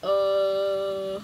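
loud held flat drone; Dylan Roof impression. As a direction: blank loud droning uh. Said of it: "that's also my Dylan Roof impression".

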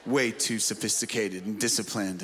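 low, smug mock snob; snooty voice. Pastor mocks a too-cool listener with a low snooty voice. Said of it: "You're like".

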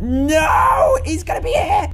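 high, drawn-out panicked shout; panicked cry. Real, not acted. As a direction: wail it in dread of being caught. Thrown item may alert the neighbor.

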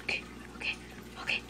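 tense whispered okays. Real, not acted. Gamer bracing during a tense round, whispering. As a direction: whisper it to steady yourself.

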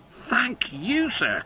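high, eager thanks; eager cry. Acted. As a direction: thank him with glee. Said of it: "cried Stepney".

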